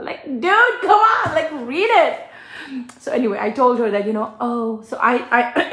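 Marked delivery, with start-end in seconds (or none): 0.0-2.2 exasperated. Real, not acted. Vlogger pleading with an absent customer, exasperated. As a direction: beg her to just read it.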